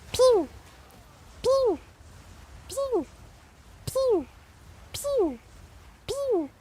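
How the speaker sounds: high-pitched, repeated pew; imitated alert sound. Streamer showing viewers the torture of constant alerts, high imitated pews. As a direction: mimic a repetitive alert, each pew high and separate. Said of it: "if they had to hear, like".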